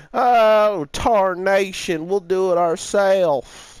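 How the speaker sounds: high, loud hillbilly cowboy drawl; old prospector. Retold or imitated. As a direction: ham up a grizzled old prospector. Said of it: "I picture these stupid cowboy hat wearing anime characters".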